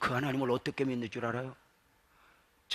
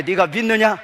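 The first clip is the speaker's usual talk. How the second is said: high, loud question to the congregation; louder challenge; 7 semitones higher, 14 dB louder than usual. Shown as a demonstration. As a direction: call it out for a big response. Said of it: "더 크게". (더 크고 높게 외쳐 묻기)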